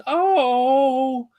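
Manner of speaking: high, slow, sweet gushing voice; drawn-out delight, voice lifted high; gushing girlfriend. Retold or imitated.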